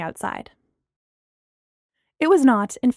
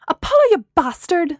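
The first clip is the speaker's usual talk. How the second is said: loud, high shout into a phone; angry shout; 7 semitones higher, 6 dB louder than usual. A narrator's reading. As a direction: shout the insult down the line. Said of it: "she shouted into the mouthpiece".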